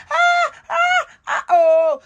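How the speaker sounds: very high mock cries of pain; hammed-up yelps of pain, loud and high; imitated pained shout. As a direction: cry out like a woman getting an injection. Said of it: "The wife come dey shout".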